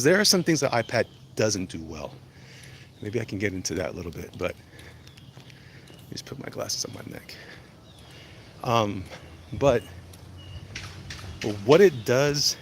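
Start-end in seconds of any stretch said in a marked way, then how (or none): 5.9-7.3 unvoiced side whisper; whispered aside. Real, not acted. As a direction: whisper it to yourself. Muttered aside while adjusting glasses.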